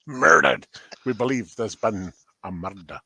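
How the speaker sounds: low, grave mock-detective voice. Riffing on a murdered French accent, mock detective. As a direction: put on a deep, solemn police-drama voice.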